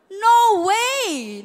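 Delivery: No way shouted out; big emphatic no way from the pulpit; loud protest. Real, not acted. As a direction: reject it with full force. Preacher rejects the idea.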